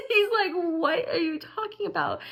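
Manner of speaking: high, cracking with laughter; half laughing, half crying; laughing through it. Real, not acted. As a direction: quote him while laughing. Laughing while quoting husband.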